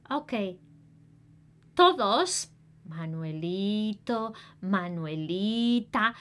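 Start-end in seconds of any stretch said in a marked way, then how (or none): none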